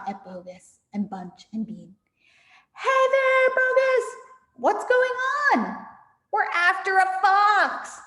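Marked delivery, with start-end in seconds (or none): 2.7-5.9 much higher, loud call down; calling villager. Acted. Crowd calling down to the farmers in the crater. 6.3-8.0 high, loud defiant shout; shouted reply. Acted. Bogus shouting up to the jeering crowd.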